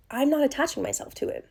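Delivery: ordinary talk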